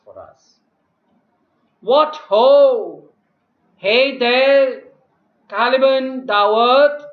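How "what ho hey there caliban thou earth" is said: loud summons across the island; calling out. Acted. Prospero calls his slave Caliban, loud and bossy. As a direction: shout for Caliban like a master.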